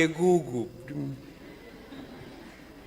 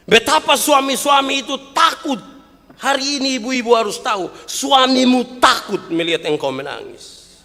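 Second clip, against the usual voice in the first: high, loud, driving sermon voice; fiery pulpit delivery; preaching at full force; 12 semitones higher, 8 dB louder than usual. Acted. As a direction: hammer the point to the mothers.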